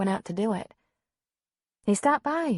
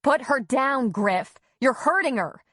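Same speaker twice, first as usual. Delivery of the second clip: higher, louder alarmed demand; urgent protest; 6 semitones higher, 4 dB louder than usual. A narrator's reading. Mother to violent husband on the stairs, urgent protest. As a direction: plead forcefully to protect your child.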